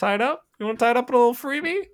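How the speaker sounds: very high, fast squeaky voice, teasing the refs for gifting a power play; a cartoonish high-pitched ref taunting the Penguins; mock referee voice. Mocking the referee's fake generosity.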